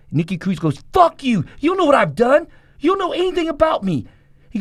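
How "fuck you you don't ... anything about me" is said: high furious yelling at the preacher; angry shout. Retold or imitated. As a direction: snarl it back, much higher and louder. Nicky Cruz cursing the preacher.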